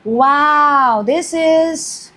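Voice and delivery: delighted gasp before the words. Real, not acted. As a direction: catch your breath at the good card. Drawing an exciting card.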